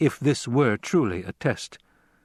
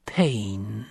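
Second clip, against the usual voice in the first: whisper. A narrator's reading. Old woman answers with menace.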